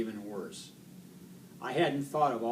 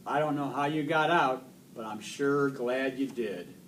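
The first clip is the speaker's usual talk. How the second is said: ordinary delivery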